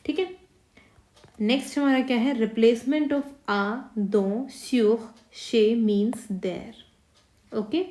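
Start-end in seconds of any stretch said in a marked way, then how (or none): 2.3-7.6 very slow, drawn-out chez means there; slow rule reading. Shown as a demonstration. Teacher slowly dictating the replacement rule. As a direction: drag each word of the rule. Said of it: "reading a rule slowly".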